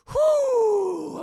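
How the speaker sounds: high, loud hooted exhale; big whew. Retold or imitated. Agent composing himself after the phone call, big whew. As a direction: let out a long, whooping sigh.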